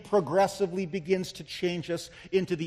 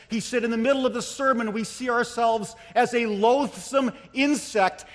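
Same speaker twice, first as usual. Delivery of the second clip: raised, driving sermon voice; full-force preaching; 4 semitones higher, 6 dB louder than usual. Real, not acted. Preacher quoting Jonathan Edwards on sinners over hell, urgent preaching. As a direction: keep the fervor up through the quotation.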